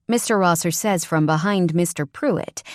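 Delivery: usual speaking voice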